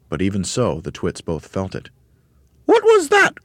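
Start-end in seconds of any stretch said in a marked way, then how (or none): 2.6-3.4 very high, loud screech; startled cry. A narrator's reading. Mrs Twit feels something land on her head, crying out. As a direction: shriek it in alarm. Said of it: "cried Missus Twit".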